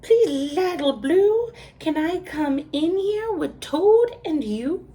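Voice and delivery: high, loud pleading goat. A narrator's reading. The goat asks Little Blue to come in, high pleading. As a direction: beg to come in, high-pitched. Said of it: "said the goat".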